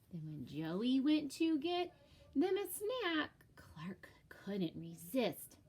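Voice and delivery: ordinary delivery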